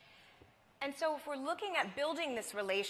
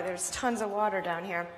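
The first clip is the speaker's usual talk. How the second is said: ordinary talk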